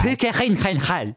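high POO-ka khin-khen-HAL for Puka Gyllenhaal; absurd mispronunciation. Pretend. Co-host repeating his joke, Puka Gyllenhaal high and guttural.

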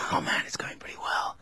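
soft, breathy whisper into the mic. Real, not acted. Co-host aside on air, whispering. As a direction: whisper it secretly to your co-host. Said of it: "Stop whispering".

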